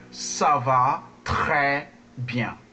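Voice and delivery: slow "très" with a gargled French R. Shown as a demonstration. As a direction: say "très" like water in your mouth, slowly. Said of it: "like you have water in your mouth".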